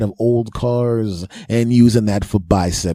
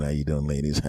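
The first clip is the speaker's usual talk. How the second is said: smooth quiet; 7 semitones lower, 9 dB quieter than usual. Retold or imitated. Hiding breathlessness, smooth.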